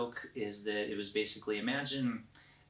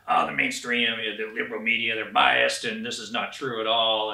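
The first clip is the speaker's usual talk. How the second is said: mocking conservative; 10 dB louder than usual. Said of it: "they'll be like".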